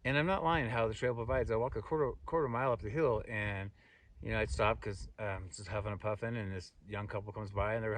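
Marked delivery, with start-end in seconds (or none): none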